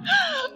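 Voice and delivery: high startled gasp; embarrassed little gasp; sharp gasp. Acted. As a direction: caught off guard, gasp. Mother's teasing remark.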